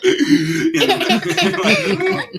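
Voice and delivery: high-pitched laughing speech. Real, not acted. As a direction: giggle through the words. Laughing while retelling.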